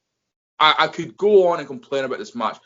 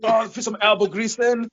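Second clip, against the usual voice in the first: mock cleaner; 8 semitones higher than usual. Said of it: "you're like".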